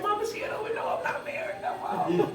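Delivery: high, breathy laughing quote; laughing aside to mama. Retold or imitated. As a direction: giggle it out to mama. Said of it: "And I said".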